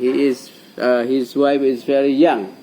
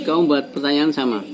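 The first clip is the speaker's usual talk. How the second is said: normal talking voice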